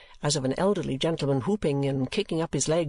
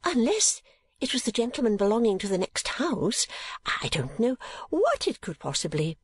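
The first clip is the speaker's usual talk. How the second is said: high, wondering guess; startled; 8 semitones higher than usual. A narrator's reading. Mother guessing at the noise, high and startled. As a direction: puzzle it out, startled. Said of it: "considerably startled".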